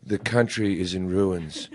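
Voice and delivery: low, grave doom-laden political ad read; lightly mock a gloomy billionaire ad; morbid ad voice. Mocking Tom Steyer's gloomy CNN ad, low and somber. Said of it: "where he goes".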